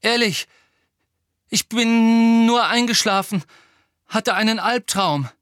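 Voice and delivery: high, loud, agitated excuse; stammered insistence. A narrator's reading. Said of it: "stammelte Harry".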